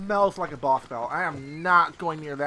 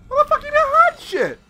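much higher, loud surprise; shocked squeal; 9 dB louder than usual. Real, not acted. Player finds treasure and squeals. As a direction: squeal at the loot.

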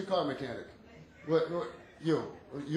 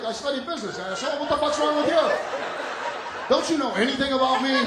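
higher, louder indignant rant; mock indignant; 7 semitones higher, 5 dB louder than usual. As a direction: play the offended audience man, worked up. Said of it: "He's looking at me like".

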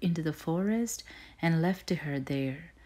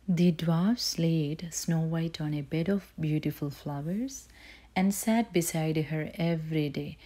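usual speaking voice

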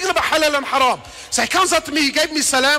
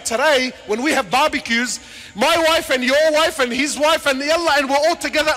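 ordinary delivery

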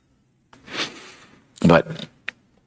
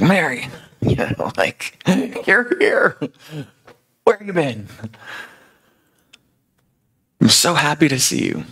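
high-pitched delighted greeting with laughter; overjoyed, laughing; 10 semitones higher than usual. Acted. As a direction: greet a loved one, bursting with joy. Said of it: "overjoyed. He's laughing".

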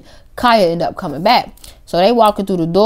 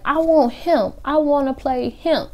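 put-on drunk girl; 8 semitones higher, slower than usual. Drunk girl voiced in story.

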